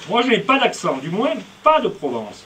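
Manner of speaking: mock-offended loud denial; indignant insistence. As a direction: insist loudly that you have no accent. Insisting he has no accent.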